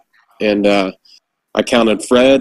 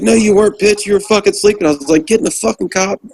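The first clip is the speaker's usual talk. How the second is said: much higher mock-angry scolding of a sleeping private; retold chewing-out; 9 semitones higher than usual. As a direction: chew him out, pitched way up. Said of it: "I was so mad".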